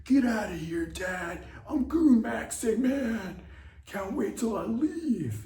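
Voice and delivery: put-on high, loud bratty teen yelling at dad; shrill-pitched brat shooing his father away; whiny teen son.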